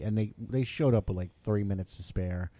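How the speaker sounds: ordinary delivery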